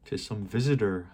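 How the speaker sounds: ordinary delivery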